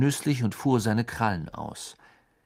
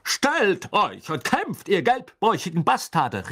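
high, swooping blustering knight; Sir Cadogan; 9 semitones higher, 4 dB louder than usual. A narrator's reading. Knight in portrait challenges them to fight, blustering. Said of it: "rief Sir Cadogan".

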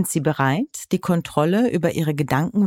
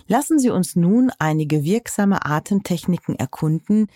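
ordinary talk

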